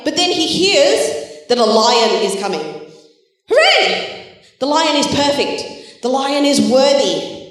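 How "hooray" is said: high, bright cheer of relief; cheering hooray. Acted. Children's leader cheering the lion's arrival with excitement. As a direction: cheer like the rescue has come.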